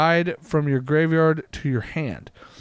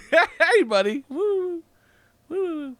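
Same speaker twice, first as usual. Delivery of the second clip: pet talk; 11 semitones higher, much slower than usual. Real, not acted. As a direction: gently coo at the cat. Calling to cat.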